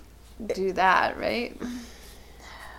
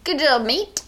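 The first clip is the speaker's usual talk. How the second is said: higher-pitched, botched Australian attempt; bad Australian accent; 6 semitones higher, 3 dB louder, slower than usual. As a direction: have a shaky go at sounding Australian. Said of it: "I cannot do an Australian accent".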